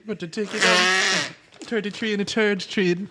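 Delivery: high-pitched put-on Irish lilt; Irish accent. Friend joining in on the bit, mock Irish accent. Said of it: "I was about to do Jamaican".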